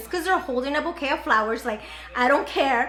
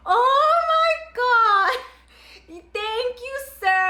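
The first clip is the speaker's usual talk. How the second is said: high, loud wailing half-sung thanks; a retold teary gush, high and loud; mock crying; 10 semitones higher, 4 dB louder, much slower than usual. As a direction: overcome with emotion, hammed up. Overwhelmed by anniversary flowers.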